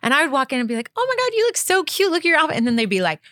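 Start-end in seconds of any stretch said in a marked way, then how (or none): 1.0-2.6 put-on high-pitched girly compliment; squealy-sweet, much higher imitation of herself; gushing greeting. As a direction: gush like greeting your best girlfriend. Acts out her gushing greeting.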